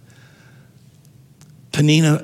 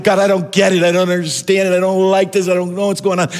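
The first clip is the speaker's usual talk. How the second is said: anguished prayer; 5 semitones higher, faster than usual. Acted. Hannah's anguished prayer voiced aloud.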